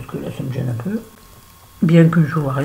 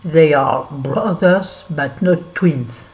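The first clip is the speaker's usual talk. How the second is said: slow English quote; much slower than usual. Shown as a demonstration. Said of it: "Comment c'est la phrase en anglais".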